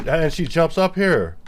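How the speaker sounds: excited. Real, not acted. Cat jumps onto desk.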